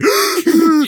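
high-pitched imitated straining; mock effort noise; straining push noise. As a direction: act out shoving with all your might. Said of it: "giving it all I fucking got".

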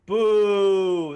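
loud, high booing at the screen. Real, not acted. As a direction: boo the crowd, full voice.

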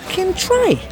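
high, scandalised squeal; outraged disbelief. Retold or imitated. Prisoner seeing queue jumper's tray, high outraged squeal.

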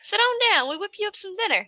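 folksy Southern welcome to dinner; Southern farmer. Retold or imitated. Farmer inviting guests to eat, high Southern accent. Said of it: "thick Southern accent".

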